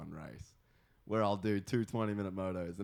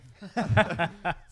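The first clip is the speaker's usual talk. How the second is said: loud high burst of laughter; big laugh; 10 semitones higher, 10 dB louder than usual. Real, not acted. Group banter, big laugh.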